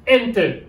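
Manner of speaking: "Enter" with a punched opening E, falling after; teacher's demo of "Enter", stressed at the front; stress on first syllable. Shown as a demonstration. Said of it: "L'accent, c'est sur le E".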